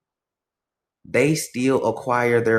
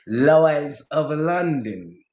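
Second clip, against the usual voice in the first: very slow, higher mock British; Southern accent disappearing; 4 semitones higher, much slower than usual. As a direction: draw out a put-on British accent. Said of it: "his Southern accent kinda disappeared".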